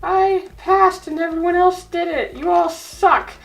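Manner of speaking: high, smug bragging voice; bragging girl. Retold or imitated. Lainey bragging to classmates, high smug voice. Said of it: "She's like".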